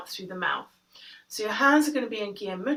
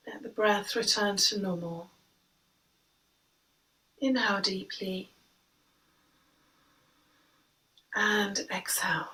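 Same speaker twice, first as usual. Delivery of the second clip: guided meditation. Acted. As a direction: guide the breath gently, unhurried. Leads breathing meditation.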